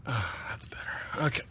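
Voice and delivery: whisper. Real, not acted. Close to the microphone.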